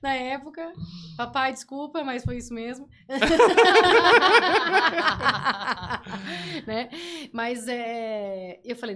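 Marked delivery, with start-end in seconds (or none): 3.1-6.6 long, loud laugh. Real, not acted. Laughing after confessing to her dad. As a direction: laugh it off loudly.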